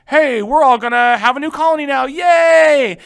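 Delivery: hammed-up rallying yay; mock cheerful king. Teacher voicing Charles the Tenth distracting the people, loud and high.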